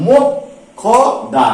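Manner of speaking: মোক্ষদা very slowly as mok, kho, da; syllable-by-syllable pronunciation. Shown as a demonstration. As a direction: break মোক্ষদা into three parts with long pauses. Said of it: "উচ্চারণটা কি করলাম?".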